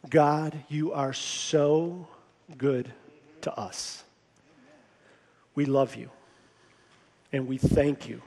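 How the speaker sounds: low, soft, measured praying voice; reverent prayer. Real, not acted. Pastor thanking God on behalf of the congregation, reverent prayer. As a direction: pray gently, pausing between phrases.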